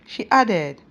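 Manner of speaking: ordinary delivery, nothing marked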